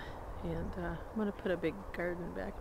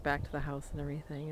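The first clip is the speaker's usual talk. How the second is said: normal talking voice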